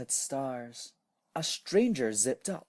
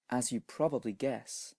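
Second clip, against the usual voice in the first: usual speaking voice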